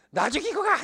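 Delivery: high, loud mock outburst of the wife; imitating furious wife. Wife blocking Abraham at the door, shouting in mock fury. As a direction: shout it as the wife forbidding him to go. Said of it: "이랬을 거란 말이에요".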